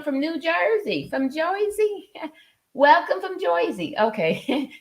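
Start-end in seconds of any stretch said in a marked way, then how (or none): 1.1-2.1 high, slow, playful "Joisey" accent; hammed-up Jersey accent, pitched way up; Jersey accent attempt. Shown as a demonstration. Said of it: "That was my best little attempt". 2.7-3.9 high-pitched, playful "Joisey" accent; hammed-up Jersey accent, pitched up; Jersey accent attempt. Shown as a demonstration. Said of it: "That was my best little attempt".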